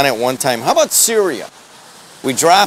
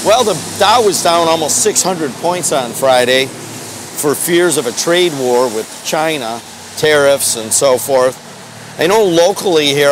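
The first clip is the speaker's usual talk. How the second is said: ordinary delivery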